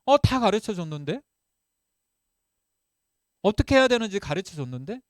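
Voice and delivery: acted-out bewilderment; puzzled thought. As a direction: protest inwardly that it was all taught. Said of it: "어떤 생각이 드냐면".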